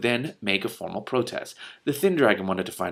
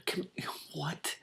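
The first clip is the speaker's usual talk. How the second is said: whispered what; 7 semitones higher, 11 dB quieter than usual. Real, not acted. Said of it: "reader baffled mid-chapter".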